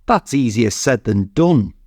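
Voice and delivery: plain reading voice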